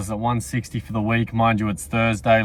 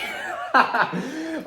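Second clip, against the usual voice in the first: high giggle, cracking up; embarrassed high laugh. Real, not acted. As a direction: burst into a high-pitched laugh at yourself. Laughing at own phrasing.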